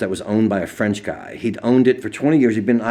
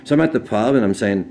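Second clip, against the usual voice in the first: ordinary talk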